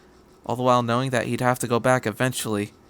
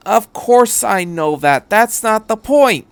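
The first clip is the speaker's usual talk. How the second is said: louder, higher, x0.6 slow and pointed; irritated retort; 9 semitones higher, 7 dB louder, slower than usual. Acted. Misato to Ritsuko on the phone, irritated. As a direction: spell it out with irritation.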